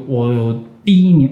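normal talking voice

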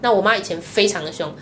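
ordinary talk, nothing marked

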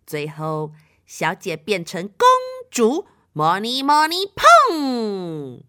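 slow, deliberate magical incantation; drawn-out sorceress chant; casting a spell. Acted. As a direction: chant each line slowly, ending in a loud boom. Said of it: "你们听她的咒语哦".

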